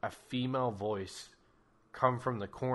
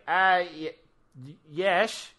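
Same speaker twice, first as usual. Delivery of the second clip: nervous stammer; 7 semitones higher, 4 dB louder than usual. Acted. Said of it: "Ricky is like".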